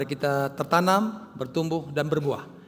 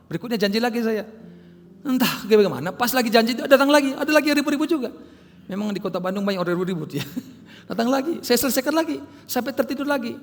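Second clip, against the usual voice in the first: excited retelling; 8 semitones higher, 5 dB louder than usual. Retold or imitated. Excited retelling of repeated interruptions.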